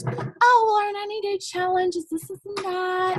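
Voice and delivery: exaggerated high-pitched hedging pitch; mock salesperson voice. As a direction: mimic an evasive seller in a high voice. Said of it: "I don't know why I'm talking like this".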